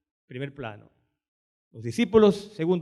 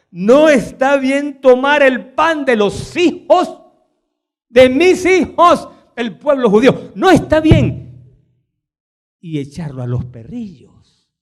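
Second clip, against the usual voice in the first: Jesus' stern words; 5 semitones higher, 9 dB louder than usual. Retold or imitated. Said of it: "y él le dijo".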